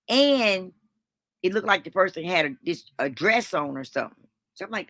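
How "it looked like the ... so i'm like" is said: usual speaking voice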